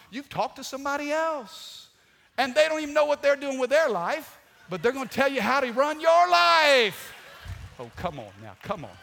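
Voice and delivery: loud, high, driving preacher voice; full-force preaching. Acted. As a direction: preach it hard to the crowd. Preacher building to a peak.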